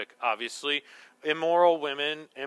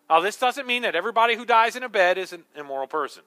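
normal talking voice

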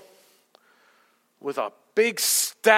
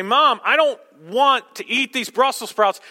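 put-on whiny child; 6 semitones higher, 6 dB louder than usual. Child pleading with mom.

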